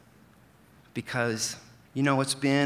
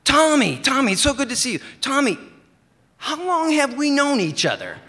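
high, loud, eager friend's voice; Brian's eager voice; 13 semitones higher, 7 dB louder than usual. Retold or imitated. Brian greets his mail carrier, high and loud. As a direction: pitch it way up and call out to your old buddy.

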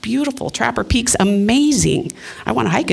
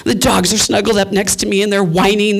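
usual speaking voice

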